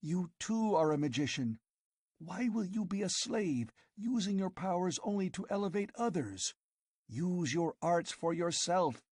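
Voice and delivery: put-on girl pushing the magician to defy his masters; furious urging. A narrator's reading. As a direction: shake him with words. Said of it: "in the fury of her emotion".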